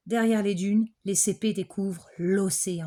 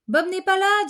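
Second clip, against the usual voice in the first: put-on high, louder child calling out; 11 semitones higher, 6 dB louder than usual. Basile tells the teacher Bob is missing, high child voice. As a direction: sound like a worried little boy.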